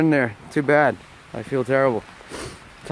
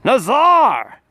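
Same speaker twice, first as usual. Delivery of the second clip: magic shout; 4 semitones higher, much slower than usual. Pretend. Writing a follower's name in the snow, shouting it like Shazam. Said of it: "Nazar sounds like the word Shazam".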